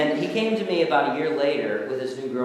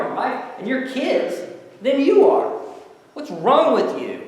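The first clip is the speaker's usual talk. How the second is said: exasperated scolding; 3 semitones higher, 7 dB louder than usual. Retold or imitated. Chaplain confronting inmates about their recovery, building exasperated yell. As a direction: scold them, rising to a near yell. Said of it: "because I said to them".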